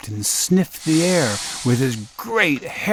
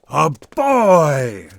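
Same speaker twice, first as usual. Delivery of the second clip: loud, startled giant cry; 4 semitones higher, 5 dB louder than usual. Acted. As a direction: bellow it in surprise. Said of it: "cried the giant".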